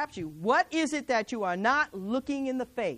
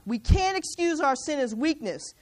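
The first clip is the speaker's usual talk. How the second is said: normal talking voice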